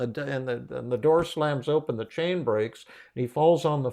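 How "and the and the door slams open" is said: ordinary delivery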